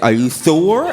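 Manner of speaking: snippy, "sure" as "thure"; doubtful, lisped question; lisping dentist. Retold or imitated. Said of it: "he was getting snippy with it".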